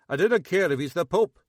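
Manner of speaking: loud, jaw clenched, furious; through gritted teeth. Acted. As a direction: grind the words out angrily. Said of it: "grinding his teeth".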